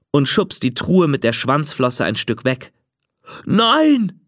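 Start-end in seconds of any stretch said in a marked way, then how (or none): 3.5-4.2 ghost crying out. A narrator's reading. Ghost begs Lilly not to push away, crying out. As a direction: yelp as if about to be left behind. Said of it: "schreit der Geist".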